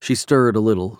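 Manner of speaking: usual speaking voice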